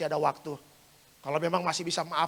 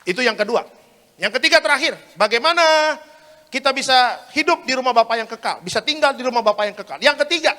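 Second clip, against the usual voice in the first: loud, projected preacher announcing a point; emphatic preaching; 7 semitones higher, 12 dB louder than usual. Acted. Preacher introducing the third and final point, emphatic preaching. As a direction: build up the next point for the hall.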